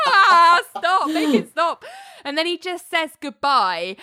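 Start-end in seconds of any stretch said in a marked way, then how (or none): 0.1-1.8 loud, high cry of horror through laughter; mock horrified. Ali hearing Dennis laugh, mock horrified. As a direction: wail it in hammed-up horror. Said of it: "Ali is horrified 'cause she's like".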